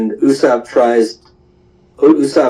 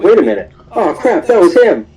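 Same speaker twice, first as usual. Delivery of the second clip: higher-pitched startled double take; sudden realization; 8 semitones higher than usual. Acted. Buggy spots who just walked away, startled realization. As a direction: realize it too late, pitch jumping up.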